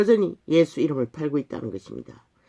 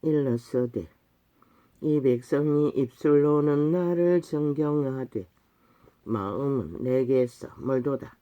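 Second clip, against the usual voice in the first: scripture recitation; 2 semitones lower, 5 dB quieter, slower than usual. Acted. Preacher reading Isaiah's rebuke of hypocrites, slow solemn recitation. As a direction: intone the verse slowly, word by word.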